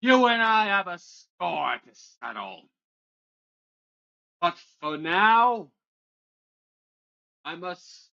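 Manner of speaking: booming voice. Acted. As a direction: boom it out like a wrathful dragon. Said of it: "you suddenly hear a foaming voice".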